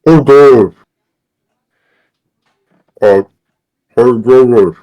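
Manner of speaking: choking on a mouthful; sputtering through a bite of food; mouth full. Real, not acted. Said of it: "Shouldn't talk with my mouth full".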